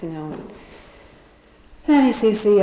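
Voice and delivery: ordinary talk, nothing marked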